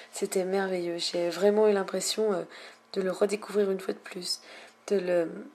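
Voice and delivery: normal talking voice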